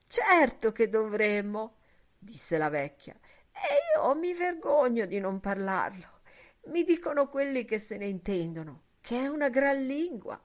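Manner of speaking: a hint of a timid old woman. Old milk woman voiced. (leggera voce da vecchia)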